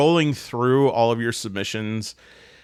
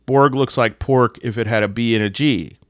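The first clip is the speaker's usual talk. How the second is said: ordinary talk, nothing marked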